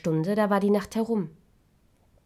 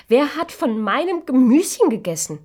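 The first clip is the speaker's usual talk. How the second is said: higher and louder, outraged; high loud indignant question; 6 semitones higher, 7 dB louder than usual. Acted. Fourth dwarf missing his vegetables, high and loud. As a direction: cry it out indignantly.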